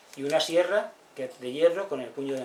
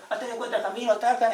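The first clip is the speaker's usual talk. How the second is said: higher, quick chiming in; eager advice; 8 semitones higher, 5 dB louder than usual. Retold or imitated. Villagers pitching in together, higher and eager. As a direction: toss in a tip eagerly.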